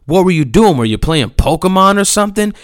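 loud, high mocking imitation of blame; mock accusation. Skater quoting the husband's nerve, in loud mock accusation. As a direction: mimic the accuser with indignant force. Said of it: "had the nerve to tell me".

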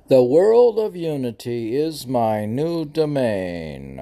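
singsong poetic cadence; verse recitation. Acted. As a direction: intone the verse with sweeping falls.

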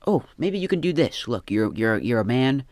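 normal talking voice

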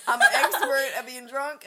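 loud, laughing boast. Real, not acted. Bragging about being the drunk uncle, laughing. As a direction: laugh through the brag.

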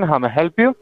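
ordinary talk, nothing marked

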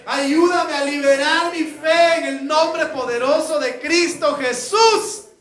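slow, loud, high shouted prayer; preacher leading the congregation in a loud prayer. Shown as a demonstration. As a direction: preach it to the rafters. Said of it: "levante sus manos conmigo esta noche y diga".

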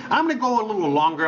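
ordinary talk

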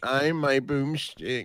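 sultry nasal sick voice, slowed down; nasally hot voice. Retold or imitated. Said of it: "And then nasally hot".

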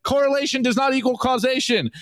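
smug check engine light shooting back. Acted. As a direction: play the smug excuse-maker. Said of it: "check engine light goes".

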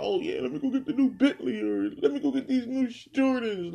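high-pitched mock of flashy rappers; mocking imitation. Imitating rappers talking about new purchases, high mocking voice. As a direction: put on a high, eager voice to mock them.

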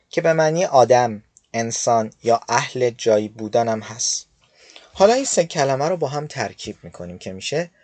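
ordinary talk, nothing marked

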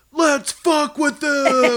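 high, slow, hammed-up shout; loud excited yell. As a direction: yell it like a ringleader. Prank call in retold party.

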